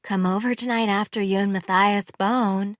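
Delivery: calling back. A narrator's reading. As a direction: call it back across the room. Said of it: "she called back over her shoulder".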